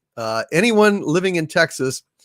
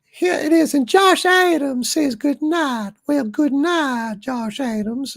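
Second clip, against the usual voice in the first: high-pitched Southern belle lilt; hammed-up falsetto belle; 13 semitones higher than usual. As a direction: play a gracious Southern lady, very high. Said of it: "Southern Belle Doomcock says".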